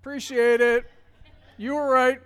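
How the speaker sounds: loud, high, begrudging sing-song; admitting to his wife she was right, begrudgingly; grudging thanks. Retold or imitated. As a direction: force out the thanks through pride.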